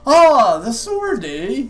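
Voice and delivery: hammed-up Canadian with a big eh; Canadian accent. Shopkeeper hands the sword over in a Canadian accent. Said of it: "What a good Canadian accent".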